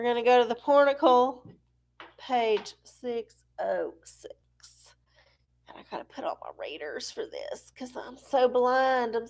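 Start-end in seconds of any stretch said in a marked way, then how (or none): 2.2-7.2 whisper. Real, not acted. Whispering the page number while searching. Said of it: "whispered page lookup".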